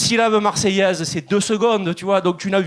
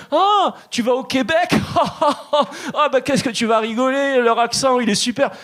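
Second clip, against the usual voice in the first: higher, excited teasing friends; French friends teasing; 6 semitones higher than usual. Retold or imitated. Friends in France teasing about the Quebec accent, excited and higher. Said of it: "ils m'ont dit".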